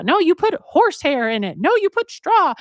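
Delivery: high, loud back-and-forth squabble; hammed-up couple contradicting each other; mock bickering. Couple arguing over the pillow stuffing, bickering. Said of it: "they're arguing about".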